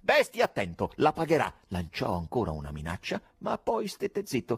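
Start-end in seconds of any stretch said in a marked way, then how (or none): 0.0-1.5 loud, high, angry warning; furious threat. A narrator's reading. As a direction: hurl the threat down the phone. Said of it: "urlò l'amministratore infuriato". (minaccia urlata con rabbia)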